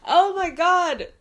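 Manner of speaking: slow, high, loud cry of overwhelm; real loud emotional outburst. Real, not acted. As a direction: burst out overcome by the ending. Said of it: "I gotta pull myself together".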